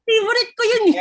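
gushing through a laugh. Real, not acted. Excited about favourite movie.

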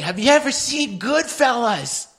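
high, drawn-out question; insistent drunken calling. Acted. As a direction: call it out high and slow. Talking over loud music, shaking him.

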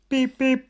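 high horn beeps. Acted. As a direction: beep beep in a bright high honk. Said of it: "Little blue truck rolled into the city".

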